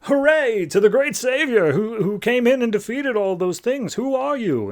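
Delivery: high, cheering crowd of freed workers; high-pitched grateful crowd voice. Retold or imitated. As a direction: hail him like a grateful mob. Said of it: "And everyone's like".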